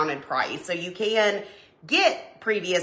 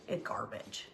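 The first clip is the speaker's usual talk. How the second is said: a hushed aside, softer, with no voice behind it; whispered verdict; 10 semitones lower, 16 dB quieter than usual. Real, not acted. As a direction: lean in and whisper it like a secret. Judging Walgreens photo quality.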